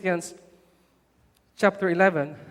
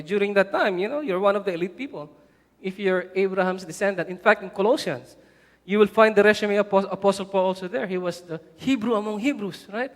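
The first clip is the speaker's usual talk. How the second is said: ordinary talk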